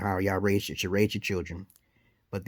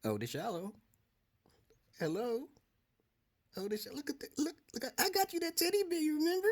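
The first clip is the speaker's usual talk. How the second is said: coaxing; 12 dB quieter, faster than usual. Real, not acted. Coaxing wife off camera.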